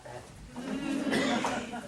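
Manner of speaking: slow, amused with a laugh. Acted. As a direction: chuckle through it. Laughing aside in role.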